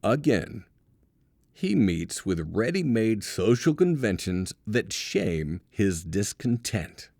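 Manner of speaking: ordinary talk, nothing marked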